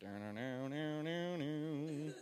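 low hummed horror-film theme; droning eerie soundtrack by mouth; imitated spooky music. Shown as a demonstration. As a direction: hum the creepy music softly. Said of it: "almost hear the music".

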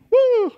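high whooping woo; excited whoop. Real, not acted.

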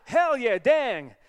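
put-on homeboy accent, loud and high; Jeffrey. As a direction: shout it in an American homeboy accent. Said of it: "that homeboy accent going on".

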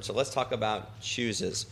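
ordinary talk, nothing marked

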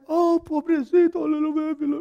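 put-on high, babying coo; mock pity for a hurt boy; mock coddling. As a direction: coo like a pitying mother. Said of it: "It wasn't like".

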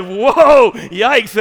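startled, comic "whoa, yikes"; taken aback. Retold or imitated. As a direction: blurt it out in mock alarm. Acts out shock at deacon's words.

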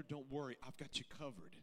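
ordinary talk

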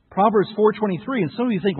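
ordinary talk, nothing marked

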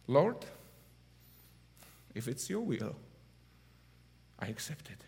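fading to a whisper on I accept it; hushed prayer. Retold or imitated. Father praying over injured daughter, fading to a whisper. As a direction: pray it under your breath.

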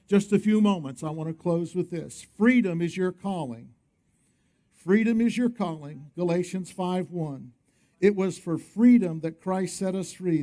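usual speaking voice

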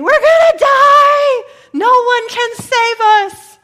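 high, drawn-out, full-volume scream of terror; panicked screaming. Acted. Disciples caught in a rising storm, screaming in panic. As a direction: scream it slowly at the top of your voice. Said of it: "then they start screaming".

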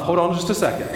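ordinary talk

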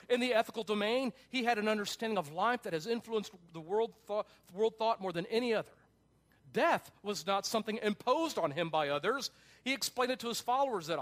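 emphatic preacher driving the point home; forceful preaching. Acted. Preaches a book quotation at force.

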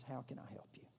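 soft whispered offer of help. Shown as a demonstration. Preacher whispering the words a hurting friend longs to hear. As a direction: breathe it gently to someone in need.

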